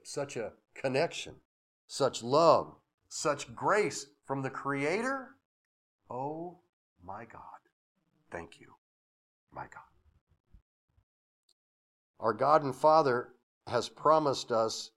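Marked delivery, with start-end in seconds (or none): 6.1-9.8 awed prayer. Real, not acted. Preacher turning to God in soft grateful awe. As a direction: breathe it out in quiet awe.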